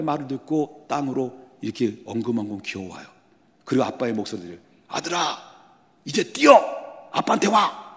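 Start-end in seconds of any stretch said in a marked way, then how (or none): 4.9-7.9 sudden loud, high cry to run; urgent shout. Retold or imitated. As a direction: call the boy to run, all out. Father shouts to run now. (아들에게 뛰라고 외치는 아빠, 다급한 외침)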